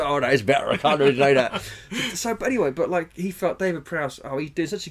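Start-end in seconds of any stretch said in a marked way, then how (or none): 0.0-1.6 loud mock rustic voice. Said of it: "Yeah, so".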